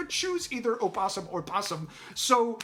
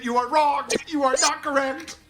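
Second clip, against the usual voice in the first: raised, emphatic scolding; heated insistence; 6 semitones higher, 5 dB louder than usual. Real, not acted. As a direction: insist loudly, higher with each denial. Arguing with partner.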